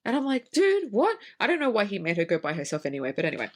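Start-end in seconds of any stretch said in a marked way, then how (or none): 0.5-1.2 high, incredulous blurt; mock-dumbfounded, voice leaping up; baffled disbelief. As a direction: react as if it makes no sense at all. Retells baffled reaction to missing husband.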